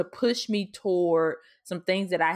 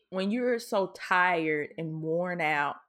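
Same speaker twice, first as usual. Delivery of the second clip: ordinary talk, nothing marked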